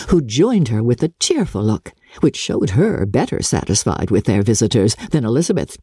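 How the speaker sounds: ordinary delivery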